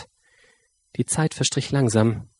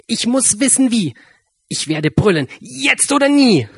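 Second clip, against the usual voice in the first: determined mouse; 10 semitones higher than usual. A narrator's reading. Mouse voiced, resolving to roar.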